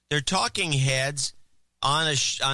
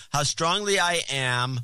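slow mocking reread; slower than usual. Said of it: "She's reading from the prompter".

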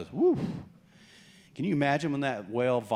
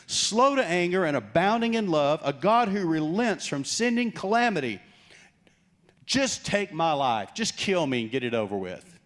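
louder, higher bitter rant; raging prophet; 5 semitones higher, 4 dB louder than usual. Acted. Jonah demanding God take his life, bitter and raging. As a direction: throw the verse back at God in a fury. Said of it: "he's raging".

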